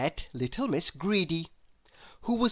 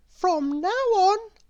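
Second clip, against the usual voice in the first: high and louder, firmly decided; high resolute voice; 14 semitones higher, 4 dB louder than usual. A narrator's reading. Little Miss Wise announcing her decision, high and determined. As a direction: declare it like a brave little vow.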